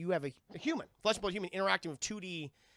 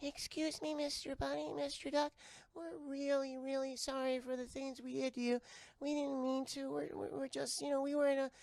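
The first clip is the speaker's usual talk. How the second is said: high, softer squeaky creature voice, pleading; tiny apologetic Monstars; 9 semitones higher, 4 dB quieter than usual. Retold or imitated. Little Monstars begging Bugs Bunny to let them stay, high timid squeak.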